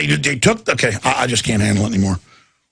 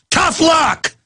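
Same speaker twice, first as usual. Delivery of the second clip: higher, mocking shout of tough luck; dismissive police chief; 10 semitones higher than usual. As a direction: sneer it like an arrogant police chief. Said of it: "the attitude of the police chief".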